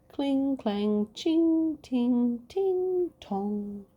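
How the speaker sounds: high ringing clangs; clanging swords. Acted. Sword noises.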